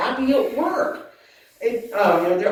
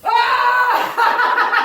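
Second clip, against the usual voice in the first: big laughing shout; 10 semitones higher than usual. Real, not acted. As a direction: let the whole breath out in one big laugh. Laughing out the deep breath.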